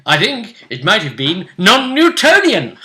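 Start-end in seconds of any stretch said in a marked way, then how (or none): 1.6-2.8 loud, higher, grand reveal; big playful announcer punch; mock-dramatic proclamation. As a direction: proclaim it with hammed-up pomp. Triumphant mock announcement of a term.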